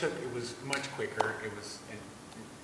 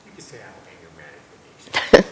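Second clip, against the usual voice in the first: laughing; 3 semitones lower, 15 dB louder than usual. Real, not acted. Confessing, laughing about anger.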